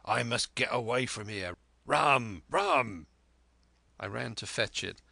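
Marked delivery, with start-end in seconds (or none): 0.0-3.1 building to loud repeated cries; desperate captain's order, rising in force; urgent demand. A narrator's reading. Captain needs rum to get away, loudly insistent.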